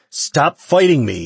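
loud, high, drawn-out shout. A narrator's reading. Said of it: "he shouted at her".

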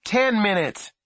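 loud high counteroffer; bargaining. A narrator's reading. Boy bargaining with his mother, called loudly. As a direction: haggle with her. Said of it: "he bargained".

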